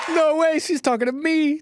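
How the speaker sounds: high, loud mock delight; excited squeal. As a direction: squeal like she's talking to you.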